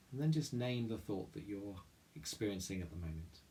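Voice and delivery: soft, calm meditation voice with long pauses; guided meditation. Real, not acted. Chapel leader guiding listeners through mindful breathing, guided meditation. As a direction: guide them gently inward, leaving room to breathe.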